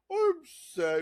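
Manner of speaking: high, loud mock-sad voice; sad cartoon fridge. Playing a sad fridge to co-host, high hammed-up wail. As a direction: wail it like a talking appliance. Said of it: "he's just like".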